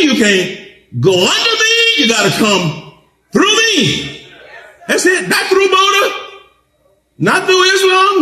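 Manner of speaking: rising sermon climax, pitch soaring; preaching at full force. Real, not acted. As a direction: build it up and hammer every me.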